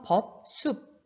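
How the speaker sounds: 법, 숲 each ending in an unreleased p; final ㅂ demonstration. Shown as a demonstration. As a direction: model 숲 as sup, lips closed. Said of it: "이렇게 발음하면 되고요".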